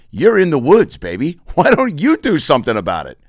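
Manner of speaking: high, loud comic comeback; mock indignant retort. Arguing back at the bear in the commercial, mock indignant.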